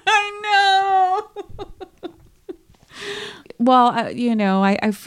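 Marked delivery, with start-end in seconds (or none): 0.0-1.6 laughing. Real, not acted. Laughing at cohost's remark.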